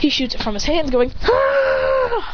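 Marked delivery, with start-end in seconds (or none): 1.2-2.3 long, high "ah" cry; drawn-out high-pitched yell; high attack scream. Acted. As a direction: scream as the water shoots out. Shooting water from hands.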